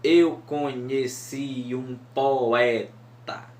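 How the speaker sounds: "Eu conheci um poeta" said slowly, beat by beat; scanning syllables. Shown as a demonstration. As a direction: read it as verse, counting beats. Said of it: "Sete sílabas".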